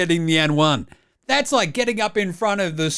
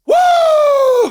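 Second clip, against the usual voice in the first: high, loud celebratory woo; hype whoop; 7 dB louder than usual. Real, not acted. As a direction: scream it like your guy just went off. Hyping rookie's debut.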